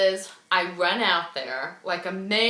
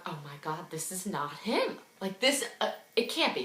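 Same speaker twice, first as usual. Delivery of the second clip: low, much softer voice; hushed disbelief; 4 semitones lower, 11 dB quieter than usual. Retold or imitated. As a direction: think it aloud quietly, not believing it. Disbelieving inner thought, half asleep.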